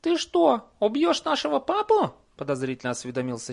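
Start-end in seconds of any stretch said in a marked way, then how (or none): 0.0-2.1 very high little troll voice; child voice. Acted. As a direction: ask like a small suspicious kid.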